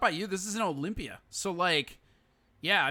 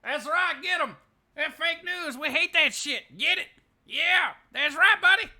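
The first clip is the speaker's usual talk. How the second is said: put-on whooping good-old-boy parody; 7 semitones higher, 9 dB louder than usual. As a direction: ham up a rally guy cheering on the singer. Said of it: "his sound clip is going to be".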